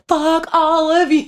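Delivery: a gleeful mock shout while walking out; triumphant yell. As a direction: stretch out a liberated parting yell. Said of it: "fist, like, walking out".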